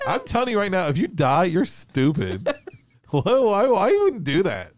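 loud, high-pitched joking scolding; hammed-up indignation, pitched high; mock-outraged rant. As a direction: rant loudly in pretend exasperation, laughing between jabs. Joking rant about dying people.

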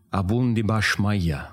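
Aramaic prayer. Shown as a demonstration. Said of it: "Let's hear it".

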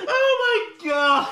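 high, slow laughing exclaim; drawn out through laughter. Real, not acted. Laughing reaction to clip.

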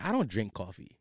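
low, quiet brush-off; retold cold, dismissive reply; cold shoulder. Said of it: "gave him the biggest cold shoulder".

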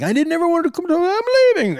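high, indignant mock girlfriend voice; huffy girlfriend. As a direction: squeak it like an offended girlfriend. Imitating girlfriend storming out.

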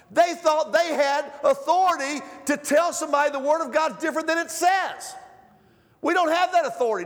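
high, loud preaching that builds to a shout; full-force preaching. Acted. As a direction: thunder it from the pulpit.

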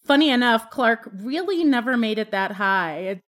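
ordinary talk, nothing marked

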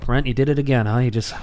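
ordinary delivery

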